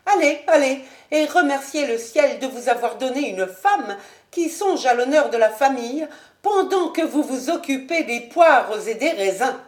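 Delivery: mock him for his pears and grapes; Lady Ashton. Lady Ashton mocks husband.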